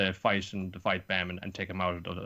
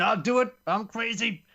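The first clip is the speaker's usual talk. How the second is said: high, louder unhinged boast; hostage-taker declaring he is crazy; manic threat; 12 semitones higher, 4 dB louder than usual. Retold or imitated. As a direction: sound like you've lost it. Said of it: "Everyone's saying".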